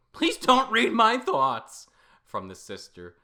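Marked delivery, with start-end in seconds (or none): 0.1-1.9 loud plea. Acted. Character snaps at Riku.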